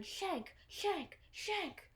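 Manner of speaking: high, falling chant at held-back volume; mock crowd shout. Everyone taunts the newcomer with a nickname, mock shouted chant. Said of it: "start shouting".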